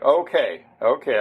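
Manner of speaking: normal talking voice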